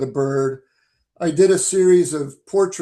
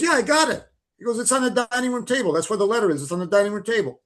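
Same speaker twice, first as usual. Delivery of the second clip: higher, a bit fast, lightly mock Massachusetts accent; New England accent; 6 semitones higher, much faster than usual. Mark telling the caller where the letter is, New England accent. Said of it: "Fidrich accent here".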